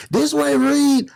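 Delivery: high, slow voiced guard's order; a hint of a stern guard; sergeant ordering. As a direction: bark it out like the guard. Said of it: "He say".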